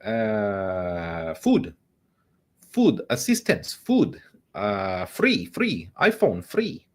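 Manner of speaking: high-pitched put-on European accent; squeaky mock European newcomer asking for handouts. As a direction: fake a halting European immigrant accent. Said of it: "would say it in a European accent".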